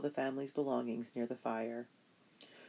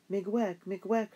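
raised, bright Ojibwe thanks, pitch lifting at the end; heartfelt thanks; 7 semitones higher, 4 dB louder than usual. Acted. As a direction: call out Miigwech gratefully, higher and louder. Parents thanking their rescuers.

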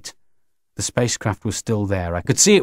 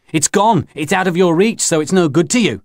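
insistent taunting; 7 semitones higher than usual. A narrator's reading. Mac taunts Grinny.

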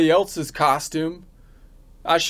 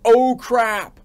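mock yell; 5 semitones higher than usual. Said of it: "It's always".

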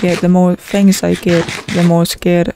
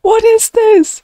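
gasp, then a much higher "What is this?"; shocked gasp; 12 semitones higher than usual. Real, not acted. Player shocked by what he finds, gasping high. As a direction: jump way up in shock.

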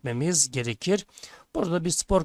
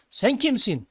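raised, calling question; mild narrated shout; 8 semitones higher, 4 dB louder, slower than usual. A narrator's reading. Boy calls to the mountain, mild narrated shout. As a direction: call out the question to an unseen stranger. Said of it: "diye bağırıyor".

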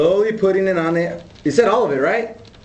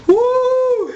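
squealed woo, burning; high pained woo; high woo from heat; 3 dB louder than usual. Real, not acted. Chili heat.

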